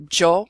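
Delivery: "yo" said like English Joe; loud "yo" with a hard j onset; regional variant. Shown as a demonstration. Teacher showing local variation, "yo" pronounced jo. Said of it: "where you'll hear".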